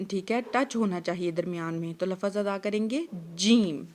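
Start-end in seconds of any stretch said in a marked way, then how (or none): 3.3-3.8 جیم with mid-tongue on the palate; Arabic jeem. Shown as a demonstration. Teacher models جیم from the palate. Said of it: "زبان کا درمیان والا حصہ".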